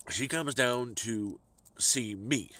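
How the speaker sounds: ordinary talk, nothing marked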